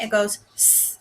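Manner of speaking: starfish split into single sounds, s-t-ar-ish; teacher sounding out s-t-ar-ish for the class; sounds segmented. Shown as a demonstration. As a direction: sound out s-t-ar-ish one phoneme at a time. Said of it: "if we're blending it".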